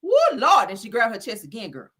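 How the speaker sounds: ordinary talk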